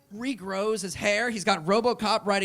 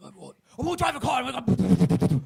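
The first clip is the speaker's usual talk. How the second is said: high, fast eager mumble; a hammed-up, eager Statham voice; excited Statham; 6 semitones higher, 4 dB louder than usual. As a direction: blurt it out like a kid.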